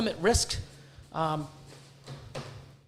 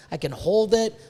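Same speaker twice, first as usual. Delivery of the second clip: usual speaking voice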